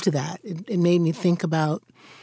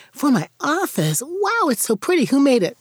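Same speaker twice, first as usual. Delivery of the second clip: high-pitched gushing surprise; delighted nana; 8 semitones higher than usual. Retold or imitated. Nana opening a present her grandchildren made, delighted. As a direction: make a big, high fuss over a child's gift.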